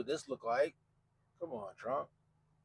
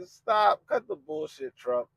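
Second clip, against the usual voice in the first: exasperated stop; 9 semitones higher, 5 dB louder, much slower than usual. Retold or imitated. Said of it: "I'm just like".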